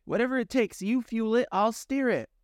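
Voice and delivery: high, determined command; decisive orders. Acted. Pandora splits the tasks with the young man, high and determined. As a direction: take charge.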